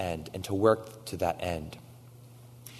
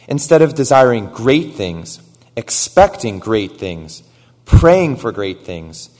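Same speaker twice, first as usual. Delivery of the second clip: loud, slow, each phrase hammered; building cry; 3 semitones higher, 14 dB louder, slower than usual. Acted. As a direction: hammer each great things harder. Triple repetition building.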